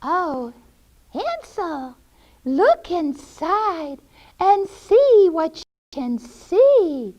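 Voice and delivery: put-on high, singsong old-lady voice; luring witch. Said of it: "and she said to him".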